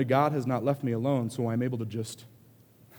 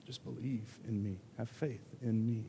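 gentle plea; 11 dB quieter than usual. Retold or imitated. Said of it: "It doesn't stop him from saying".

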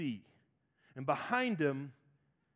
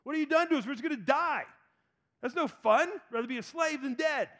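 higher, whiny, mildly indignant; mock angry complaining; 7 semitones higher than usual. Israelites complaining to Moses, mock whiny anger. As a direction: gripe like ungrateful ex-slaves. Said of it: "They get mad".

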